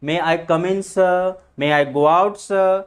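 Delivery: "sir" said as "suh", vowel left open; silent final r. Shown as a demonstration. Teacher modelling requests to leave and enter, "sir" without r. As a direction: drop the r each time you say "sir". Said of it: "इस तरह से".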